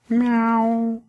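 high mewing meow; cat meow. A narrator's reading. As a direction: mew like a kitten. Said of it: "как кто-то сказал". (кошачье мяуканье)

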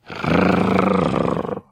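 low rolling dog growl. A narrator's reading. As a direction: growl like a big shaggy dog. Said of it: "зарычал пёс". (собачье рычание)

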